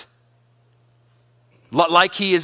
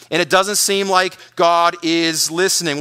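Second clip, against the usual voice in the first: normal talking voice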